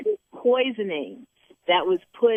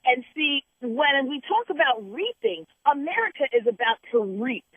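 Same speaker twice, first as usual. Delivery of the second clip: preaching; 6 semitones higher than usual. Real, not acted. Host preaching to a listener about reaping, high and intense.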